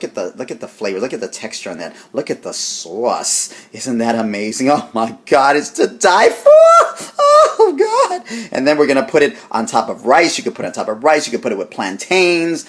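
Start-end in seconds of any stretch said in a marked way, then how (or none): 4.7-8.5 high squeal of delight, laughing. Real, not acted. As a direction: squeal like it's to die for. Ecstatic over the taste.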